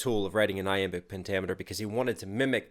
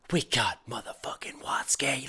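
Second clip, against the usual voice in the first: stunned half-whisper, slightly softer; hushed amazement; 5 dB quieter than usual. Real, not acted. Reactor stunned by the guest rapper, hushed amazement. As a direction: breathe it out in disbelief.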